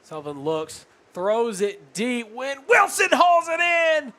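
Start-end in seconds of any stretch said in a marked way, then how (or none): 1.1-4.1 rising shouted football call; excited play-by-play. Real, not acted. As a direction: call the big catch at full volume. Live touchdown-drive play-by-play.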